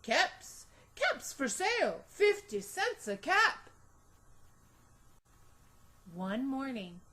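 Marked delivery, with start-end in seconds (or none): 0.0-3.7 calling his wares up high and loud; peddler calling out. A narrator's reading. Peddler hawking caps in the street, calling out. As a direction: cry caps for sale like a street seller. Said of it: "he called".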